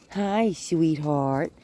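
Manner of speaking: slow, drawn-out cooing hello; sweet greeting to a dog. Real, not acted.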